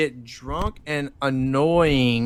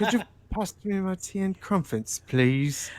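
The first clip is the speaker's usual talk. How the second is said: English accent attempt; 7 dB quieter than usual. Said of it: "I'll try to emulate".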